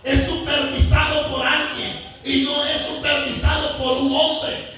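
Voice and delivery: usual speaking voice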